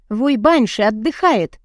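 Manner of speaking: usual speaking voice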